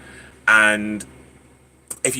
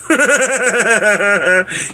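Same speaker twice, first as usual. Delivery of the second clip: loud, high burst of laughter; 10 semitones higher, 5 dB louder than usual. Real, not acted. Amused speaker teasing Amy, loud high laugh.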